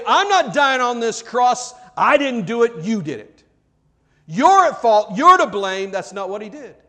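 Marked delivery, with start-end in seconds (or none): 0.1-5.2 loud, raised-pitch accusing protest; indignant refusal. Acted. Acts out what Christ did not say. 5.2-5.9 high, forceful finger-pointing blame; loud accusation. Acted. What Christ did not say, accusing.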